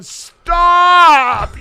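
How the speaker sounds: long, high, loud imitated cry; mock wife's yell. Wife to husband from the porch, imitated yell. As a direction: holler it like an exasperated wife.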